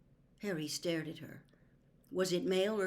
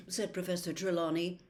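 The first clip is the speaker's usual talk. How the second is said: ordinary delivery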